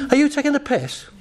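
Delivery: high pitched disbelief; man indignant at his own mind; mock outrage.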